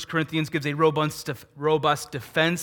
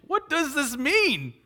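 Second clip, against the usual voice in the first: much higher, slow, baffled question; perplexed crowd; 12 semitones higher, slower than usual. Retold or imitated. Amazed crowd at Pentecost asking each other, much higher and slower. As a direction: stretch the question out in bewilderment. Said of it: "Fair question".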